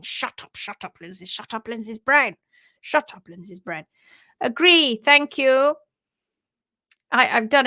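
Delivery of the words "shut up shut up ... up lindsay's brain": mock self-scolding. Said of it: "music rocking around my head and going".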